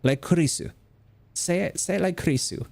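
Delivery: put-on high-pitched Japanese-accented teacher; Japanese teacher's accent. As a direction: urge the class in a Japanese accent. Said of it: "She'd say".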